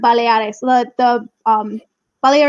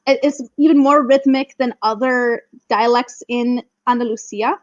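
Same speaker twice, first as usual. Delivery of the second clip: ordinary talk, nothing marked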